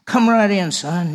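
booming, high-spirited welcome; loud friendly boss waving him in; hearty boss welcome. Retold or imitated. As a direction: greet him like a jovial salesman.

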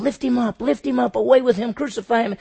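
loud, high mob chanting; crowd shout. Acted. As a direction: yell for the crucifixion like a crowd. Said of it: "they're saying".

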